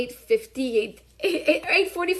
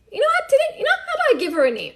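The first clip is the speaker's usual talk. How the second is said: much higher, quick imagined decision; sudden bright idea; 10 semitones higher, faster than usual. Retold or imitated. Employer spots her paper and decides to call, sudden idea. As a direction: act out a spur-of-the-moment idea.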